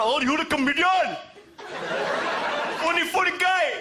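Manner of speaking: high, mocking gushing fan. Fans on the road fawning over a comedian, high mock gush. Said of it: "everywhere on the road".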